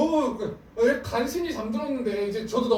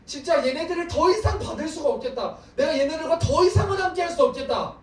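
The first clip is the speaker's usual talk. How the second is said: higher, louder, fed up; exasperated God; 9 semitones higher, 4 dB louder than usual. Retold or imitated. God gives up on his people, loud and exasperated. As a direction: declare you can't bear them anymore.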